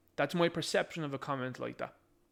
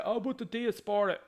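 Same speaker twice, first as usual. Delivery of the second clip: mocking whine; 6 semitones higher than usual. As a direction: toss it off like a dismissive objection. Mocking an imagined opponent.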